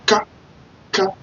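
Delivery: Ka said as a short open ka; syllable ka. Shown as a demonstration. Teacher drilling the syllable ka for learners. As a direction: say ka crisply with an open a. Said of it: "that K is going to sound like".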